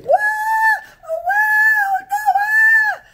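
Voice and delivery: repeated loud, very high wails; hammed-up shrieks, held long; pretend screaming. Old mother pretends panic to drive police out, screaming. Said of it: "she starts to scream".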